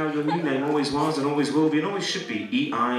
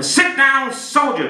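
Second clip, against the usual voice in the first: high, loud military order; Marine bellow; 11 semitones higher, 9 dB louder, much slower than usual. Shown as a demonstration. Hypnotist modelling a Marine's order to volunteers, bellowed. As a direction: bellow it like a drill command. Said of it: "you're gonna tell them a".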